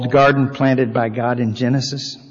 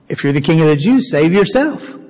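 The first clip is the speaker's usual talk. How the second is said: a touch of a jeering soldier, faster; mocking soldiers; 3 semitones higher, 3 dB louder than usual. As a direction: taunt him lightly, quick and pushy. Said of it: "the soldiers mocked him".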